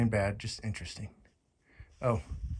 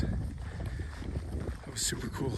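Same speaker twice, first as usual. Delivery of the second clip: whispering; much slower than usual. Real, not acted. Just heard loons nearby.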